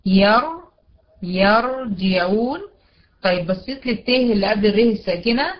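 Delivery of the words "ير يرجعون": ير held with a thick R; slow يرجعون, R heavy after the a; heavy still ra. Shown as a demonstration. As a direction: stress the full, rounded R in يرجعون. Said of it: "يبقى الري هنا مفخمة".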